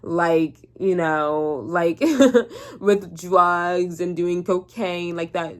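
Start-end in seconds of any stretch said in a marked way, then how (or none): 2.0-2.7 a quick giggle breaking up the list; bright burst of a laugh; short high laugh. Real, not acted. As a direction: laugh at the absurdity. Laughing mid-sentence.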